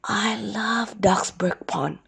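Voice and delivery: slow, hushed whisper. A narrator's reading. Duck confides in his turtle friend, whispering. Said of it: "whispered Daffy Duck".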